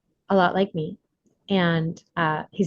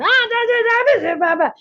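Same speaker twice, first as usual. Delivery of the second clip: put-on babbling inner child. The inner child fussing, high toddler babble. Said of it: "your inner child".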